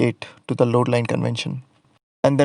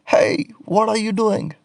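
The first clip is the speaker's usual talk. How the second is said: high, sharp call up at the painter; angry shout; 9 semitones higher, slower than usual. Acted. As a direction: yell up at him to stop. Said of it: "The angry inspectors shout above".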